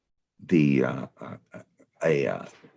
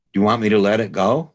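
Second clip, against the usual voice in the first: higher, louder, quick calling question; disembodied voice; 7 semitones higher, 7 dB louder, faster than usual. Retold or imitated. Disembodied voice calls to the farmer at work, calling out. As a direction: call it out like a voice from nowhere. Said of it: "It's a disembodied voice".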